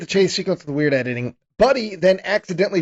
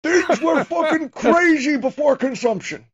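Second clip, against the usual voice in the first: put-on hyped old-timer; 10 semitones higher, slower than usual. High slow joke voice.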